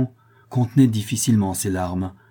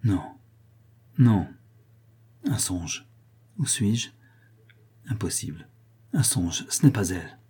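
murmured to himself in halting fragments; dazed muttering, long pauses between scraps; low, broken voice. A narrator's reading. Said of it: "il se dit à voix basse, d'une voix entrecoupée".

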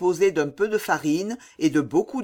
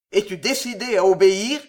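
louder, fast, harsh demand; fierce demand; 3 semitones higher, 6 dB louder, faster than usual. A narrator's reading. Captor pressing the kneeling mother, fierce. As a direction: snap the question at her. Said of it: "reprit le fauve".

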